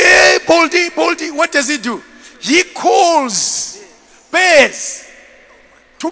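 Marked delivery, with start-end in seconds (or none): 0.0-1.3 loud, high jeering call; raised teasing holler; mocking kids. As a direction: shout a taunt like rude children. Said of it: "mock him and say".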